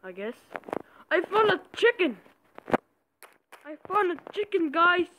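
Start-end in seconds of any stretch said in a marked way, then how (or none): 1.1-5.1 high excited shout. Real, not acted. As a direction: yell it out, thrilled. Thrilled at finding animal.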